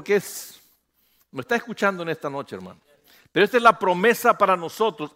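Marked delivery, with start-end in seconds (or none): 3.3-5.1 loud, higher, emphatic sermon climax; full-force preaching. Acted. Pastor thundering to the congregation that God keeps his promises, full force.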